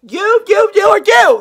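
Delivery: high, loud accusing shout. Real, not acted. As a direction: jab each you out loudly. Pointing blame around, ranting.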